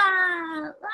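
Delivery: long high wail, fading away; defeated wail. Acted. Monster washed away by water, drawn-out mock wail. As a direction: cry out as if swept away.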